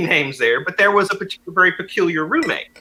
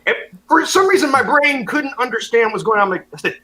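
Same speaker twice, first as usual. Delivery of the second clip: ordinary delivery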